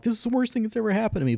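high, rushed mock complaint; fast, high-voiced exaggerated griping; mock whining.

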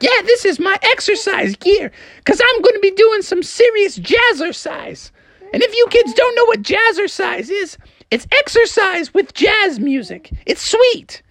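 very high, gung-ho mom voice; Froggy's mom. Acted. Mom boasts to the kids about jazzercise, high and keen. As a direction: pitch it way up, proudly keen. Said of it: "She's like".

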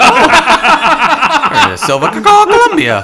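very high, loud laughing shout; laughing squeal. Real, not acted. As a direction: shout it while cracking up. Laughing at the town name.

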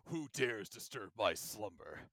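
low, slow, soft ominous vampire; ancient rasping undead king. Retold or imitated. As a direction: intone it like a waking undead. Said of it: "uh, Vincent".

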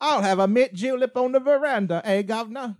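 high, loud hammed-up Southern accent turning Cockney; exaggerated, high-pitched comic Southern twang; put-on Southern accent. Shown as a demonstration. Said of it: "bait me into doing this accent".

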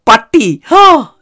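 high, loud gasp of alarm; hammed-up inner panic; mock panic. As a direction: gasp and yelp as if horrified. Retold panic in her head.

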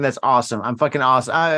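ordinary delivery

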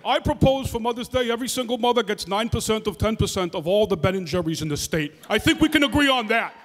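lightly voiced Bernie Sanders, emphatic pitch; Bernie impression. Bernie proposing Ben and Jerry's for mothers, mild Bernie voice. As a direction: propose it like a stump speech.

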